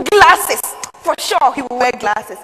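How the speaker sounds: eager certainty, punched at the start; excited guessing. Acted. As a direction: blurt it out, delighted by the idea. Excitedly imagines her writing teacher.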